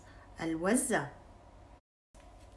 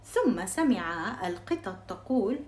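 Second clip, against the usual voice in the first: ordinary delivery, nothing marked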